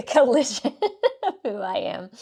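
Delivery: word breaking into a laugh; amused laughter. Real, not acted. Host tickled by her mixed-culture greeting, laughing. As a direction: laugh loudly through the word.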